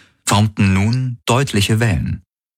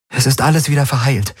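reassuring woman; 7 semitones higher, faster than usual. A narrator's reading. Kirai reassures the patient.

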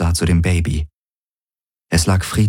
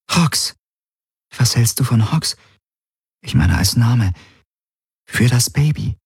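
lightly voiced woman, higher pitched; tentative Kirai; 5 semitones higher than usual. Kirai suggests a baby name to Galen, lightly voiced and tentative. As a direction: float the idea in a slightly lifted voice.

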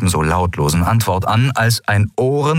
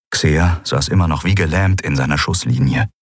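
usual speaking voice

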